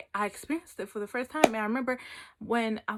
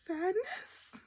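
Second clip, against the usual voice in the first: high, hesitant baffled questions; mock bewilderment; 10 semitones higher than usual. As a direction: question it in acted disbelief. Her stunned reply to friend.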